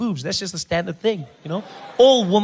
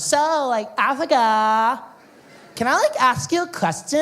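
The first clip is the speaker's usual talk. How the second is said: put-on high, breathy-free valley girl drawl, Africa stretched slow; ditzy valley girl; 7 semitones higher, 4 dB louder than usual. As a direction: play a clueless high-voiced American girl. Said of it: "she goes".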